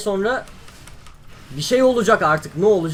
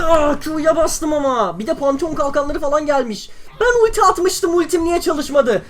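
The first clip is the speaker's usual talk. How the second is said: high exasperated complaint; 9 semitones higher, 3 dB louder than usual. Real, not acted. Abilities not firing.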